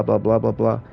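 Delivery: usual speaking voice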